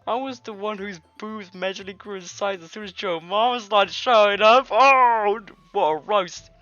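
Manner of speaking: loud bratty cheer; Natsuki's taunt. Acted. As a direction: crow over the burn at full volume. Natsuki voiced in game.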